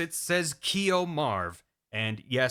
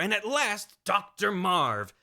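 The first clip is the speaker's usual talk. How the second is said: normal talking voice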